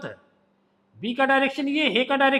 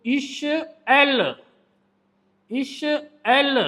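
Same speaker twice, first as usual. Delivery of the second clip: slow drill; much slower than usual. Shown as a demonstration. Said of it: "teacher drilling sounds slowly".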